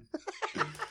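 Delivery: high silent wheezing laugh; breathless wheeze laugh. Real, not acted. Laugh after punchline.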